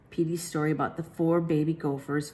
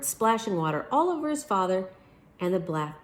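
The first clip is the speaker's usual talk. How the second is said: usual speaking voice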